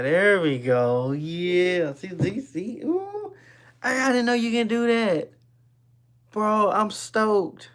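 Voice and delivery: bursting with stoked delight; gleefully showing off the new trick; real excitement. Real, not acted. Showing off the picture background.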